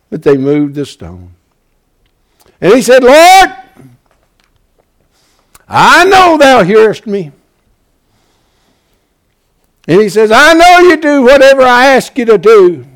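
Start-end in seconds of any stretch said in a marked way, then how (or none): 2.6-12.9 loud, high prayer cried to heaven; full-force preaching. Acted. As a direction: call out to the Lord from the pulpit.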